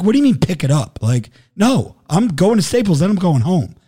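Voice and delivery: high, insistent refusal; retold indignation. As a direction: push back as if the idea is absurd. Retold refusal to hand car over.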